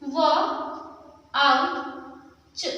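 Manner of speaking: वाउच split as व, आउ, च; sounding out. Shown as a demonstration. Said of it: "pronounce कैसे करेंगे?".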